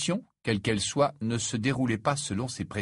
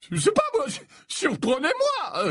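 very high, airy professor, slowing at the end; light-hearted Slughorn; 3 dB louder than usual. Acted. Slughorn answers Malfoy's challenge, light high voice. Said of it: "répondit Slughorn avec légèreté".

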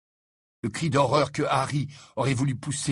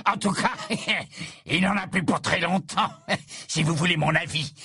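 high, giggling gloat between laughs; gloating cackle; 7 semitones higher than usual. A narrator's reading. Death Eater gloating over weak Dumbledore, high cackle. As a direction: cackle over the dying man.

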